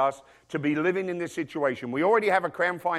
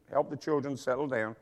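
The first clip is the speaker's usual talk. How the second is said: ordinary talk, nothing marked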